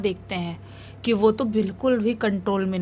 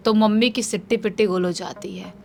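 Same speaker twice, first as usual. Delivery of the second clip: ordinary delivery, nothing marked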